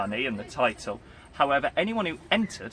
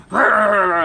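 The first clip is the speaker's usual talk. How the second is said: loud, higher barking rahs; dog barking; 7 semitones higher, 12 dB louder than usual. Retold or imitated. Big dog charging at orcs, loud barking. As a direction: bark like a big guard dog chasing raiders. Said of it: "go running out and go".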